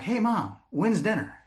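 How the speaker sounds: put-on cautious little boy. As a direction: sound innocent, testing mom carefully. Child now asks indirectly.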